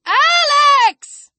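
very high, long-held cry; panicked shout. A narrator's reading. Chris desperately calls Alex back, shouting in panic. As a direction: scream the name in panic. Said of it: "now his panic was unmistakable".

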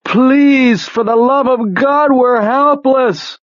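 high, desperate begging through tears; weeping plea. A narrator's reading. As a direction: plead tearfully, stretching out the final cry. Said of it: "Weeping now".

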